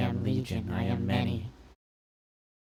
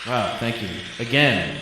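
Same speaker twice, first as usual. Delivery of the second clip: mock grateful; 3 semitones higher, 10 dB louder than usual. Reacting to the fake crowd cheering.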